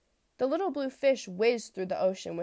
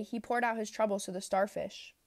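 ordinary delivery

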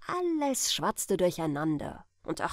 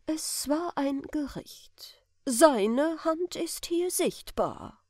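slow, higher, lightly voiced preacher; a hint of a grave parson; solemn clergyman; 5 semitones higher, slower than usual. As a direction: pronounce it like a judgement from the pulpit. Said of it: "und der Geistliche sagte".

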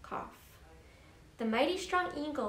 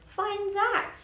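higher, louder taunting challenge; sneering; 9 semitones higher, 6 dB louder than usual. A narrator's reading. Eagle taunting Horton over the clover field, sneering. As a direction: throw it down as a mocking dare. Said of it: "sneered the bird".

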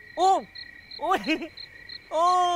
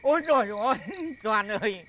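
usual speaking voice

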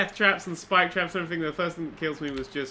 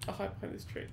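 whispering; 2 semitones higher, 12 dB quieter, faster than usual. Real, not acted. Hiding from the spider.

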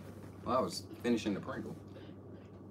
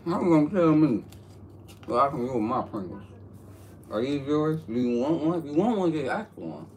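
sassy mock voice; 7 semitones higher, 6 dB louder than usual. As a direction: tease him in a squeaky diva voice. Mocking friend over his Pringles.

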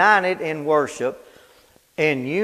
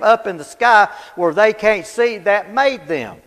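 loud, raised preacher's pulpit voice; preaching at full force; 5 semitones higher, 6 dB louder than usual. Acted. Preacher thundering at the congregation, full force. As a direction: drive it home to the congregation.

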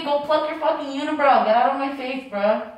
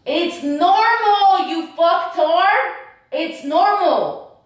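slow, loud, higher words; exasperated yell; 5 semitones higher, 6 dB louder, much slower than usual. Real, not acted. Girlfriend yelling at boyfriend over her surgery, slow and loud. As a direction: spell it out in anger.

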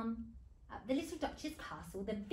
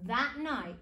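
ordinary delivery

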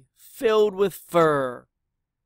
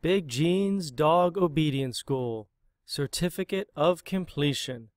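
ordinary delivery, nothing marked

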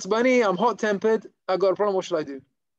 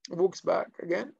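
normal talking voice

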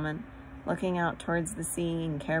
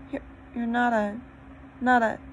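high faltering words; trembling, can't say it; shaky voice; 5 semitones higher, faster than usual. A narrator's reading. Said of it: "in a shaky voice".

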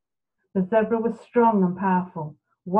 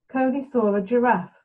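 ordinary delivery, nothing marked

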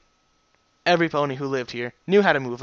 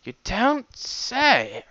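dry sarcasm; 9 semitones higher, much slower than usual. Acted. Dry remark.

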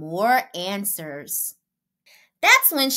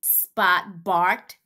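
ordinary delivery, nothing marked

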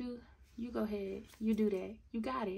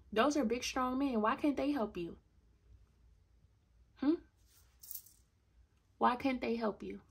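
indignant rant; 2 semitones higher, 5 dB louder than usual. Real, not acted. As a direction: tear into him for asking her help. Ranting at absent boyfriend.

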